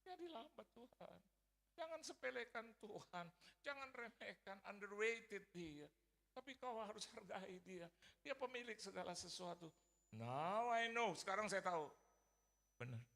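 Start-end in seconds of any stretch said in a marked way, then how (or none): 0.0-9.7 tearful mother. Retold or imitated. Mother urging her son never to belittle God, tearful. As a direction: plead high like a tearful mom. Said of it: "kalau ngomong sama dia nangis".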